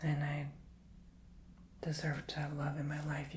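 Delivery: soft, slow, half-whispered affirmations; gentle affirmation chant for the viewer. Shown as a demonstration. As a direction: say it like a mantra you believe. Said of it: "Repeat this to yourself".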